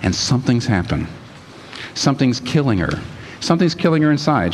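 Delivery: ordinary talk, nothing marked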